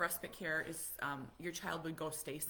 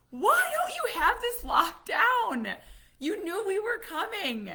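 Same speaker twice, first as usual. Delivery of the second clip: high, loud, slow scolding; exasperated scolding; 14 semitones higher, 13 dB louder, slower than usual. Retold or imitated. Mom scolding friend for no lock, high loud scolding. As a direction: scold the host in mock outrage.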